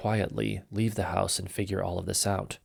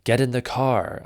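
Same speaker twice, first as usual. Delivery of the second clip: raised, higher narrated cry, short of a scream; mildly urgent, louder storyteller's shout; restrained scream; 4 semitones higher, 6 dB louder than usual. A narrator's reading. Father orders his children to flee, a restrained yell. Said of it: "I screamed at my children".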